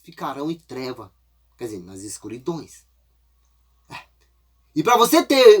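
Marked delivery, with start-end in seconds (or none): none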